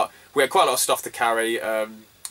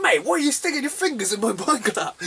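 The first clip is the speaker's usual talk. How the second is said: incredulous; 10 semitones higher than usual. Retold or imitated. Retold complaint to mate Katsu.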